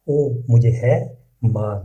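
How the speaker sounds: usual speaking voice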